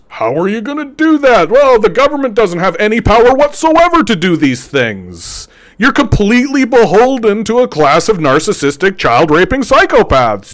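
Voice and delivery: a hint of a high, condescending naysayer; mocking voice of people who doubt government power; mock skeptic.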